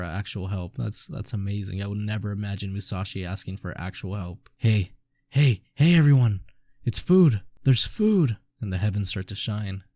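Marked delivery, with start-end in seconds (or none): 4.6-8.4 excited calling out. Acted. Villager calls out about food.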